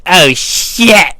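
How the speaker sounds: loud, high, drawn-out exclamation; hammed-up cry of dismay; retold alarm. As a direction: blurt it in mock panic. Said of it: "So I was like".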